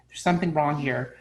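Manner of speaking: ordinary talk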